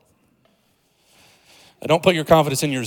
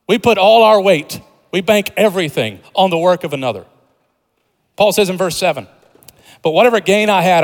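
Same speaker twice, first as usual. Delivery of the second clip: raised, emphatic pulpit voice; forceful preaching; 3 semitones higher, 6 dB louder than usual. Real, not acted. Preacher declaring confidence lies in another, forceful preaching. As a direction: proclaim it with conviction to the congregation.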